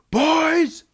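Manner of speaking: high loud summoning call; dad calling the boys in; stern yelled call. Retold or imitated.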